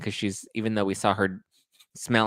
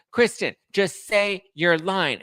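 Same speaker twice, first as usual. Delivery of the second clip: mock demanding; 10 semitones higher, 3 dB louder, slower than usual. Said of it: "she's like".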